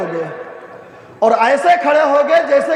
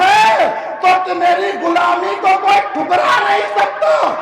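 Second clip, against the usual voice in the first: impassioned preaching; 5 semitones higher than usual. Acted. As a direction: cry it out with fervour. Preacher at full force on hadith. (پرجوش بلند خطاب)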